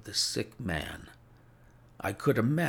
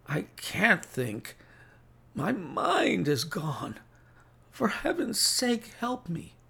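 desperate sick man; 11 semitones higher than usual. A narrator's reading. Sick Holmes begging for help, high desperate plea. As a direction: plead helplessly, dragging the words up high.

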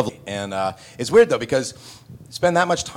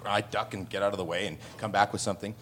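usual speaking voice

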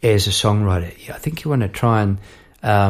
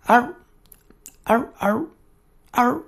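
high, spaced-out little yaps; imitated dog bark; 12 semitones higher than usual. What he hears at six in the morning, imitated barking. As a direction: yap like the dog next door. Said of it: "what am I hearing?".

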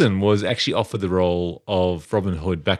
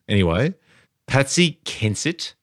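ordinary talk, nothing marked

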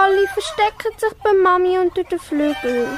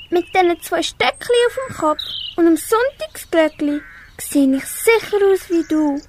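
normal talking voice

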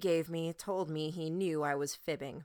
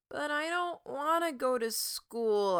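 high, slow whiny groan; drawn-out sleepy complaint; 9 semitones higher, 3 dB louder than usual. Acted. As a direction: whine it like a kid refusing school. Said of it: "I groaned".

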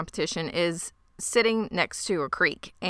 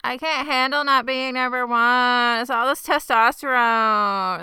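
mock Travis; 6 semitones higher, 4 dB louder than usual. Mocking Travis's inner voice.

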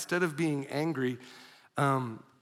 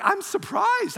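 surprised; 13 semitones higher, slower than usual. Retold or imitated. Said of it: "in my mind, I'm like".

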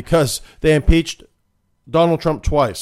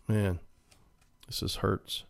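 mock heartbreak; 3 semitones lower, 10 dB quieter, slower than usual. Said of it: "choke back tears".

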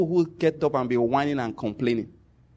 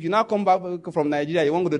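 ordinary talk, nothing marked

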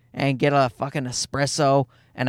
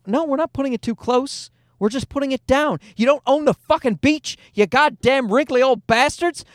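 higher-pitched mock outrage; retold scolding, pitched up; mock indignant retort; 7 semitones higher than usual. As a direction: act out telling off rude beach neighbors. Retelling wife's retort to beachgoers.

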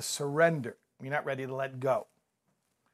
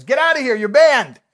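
high, loud shout of a bartender banning him; furious shouting bartender; 11 semitones higher, 14 dB louder than usual. Retold or imitated. Bartender shouting at the banned guy a year later, loud. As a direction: throw him out with a big high yell.

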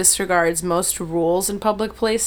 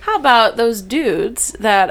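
normal talking voice

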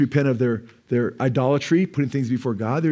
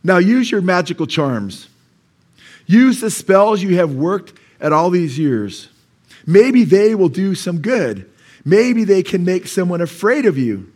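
slow, louder, higher mocking taunt; sarcastic proclamation; 4 semitones higher, 7 dB louder, slower than usual. Shown as a demonstration. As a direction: proclaim it slowly, dripping with scorn. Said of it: "sarcastic declaration".

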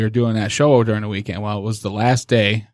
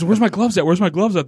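much higher, imitating a flustered girlfriend; frightened girl; 8 semitones higher than usual. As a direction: pitch it up like a panicky horror-film victim. Frightened girl looking for glove.

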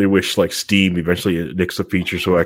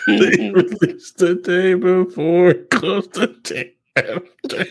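mock punchline; 11 semitones higher, much slower than usual. Joke quip about the failed game.

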